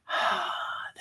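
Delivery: long, soft exhaled sigh; contented sigh. Real, not acted. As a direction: let out a quiet, lingering sigh. Sighs before saying she missed this.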